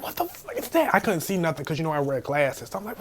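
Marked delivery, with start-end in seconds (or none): 0.0-0.9 high alarmed cry; shocked at the shape in the dark; startled. Retold or imitated. Spotting something circling him in the graveyard, high alarm.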